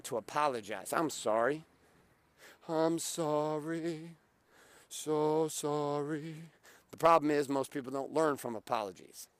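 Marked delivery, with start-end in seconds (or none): 2.7-4.2 very slow, droning, stretched-out sorry; mock apology. Man mocking his habit of constant apologizing, droning mock apology. 4.9-6.5 very slow, droning, stretched-out sorry; mock apology. Man mocking his habit of constant apologizing, droning mock apology.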